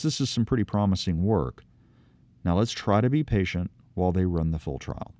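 normal talking voice

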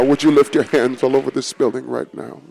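quiet invitation to lift hands. Acted. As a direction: repeat it hushed and earnest. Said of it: "repeated softer".

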